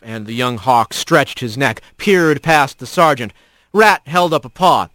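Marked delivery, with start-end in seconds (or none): none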